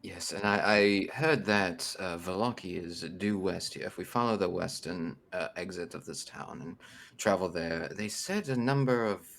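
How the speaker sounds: low, hushed report to companions; subdued in-character voice; soft-spoken adventurer. Acted. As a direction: relay directions quietly, trailing nearly to a whisper.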